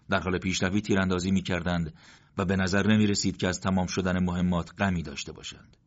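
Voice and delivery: ordinary delivery, nothing marked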